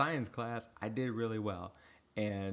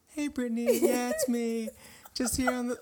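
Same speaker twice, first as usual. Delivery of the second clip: high put-on voice, a little louder; nervous teen faking a high voice on the phone; higher pitched voice; 13 semitones higher, 6 dB louder than usual. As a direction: squeak it up high to hide a breaking teen voice. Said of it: "so I talk like this the entire time through".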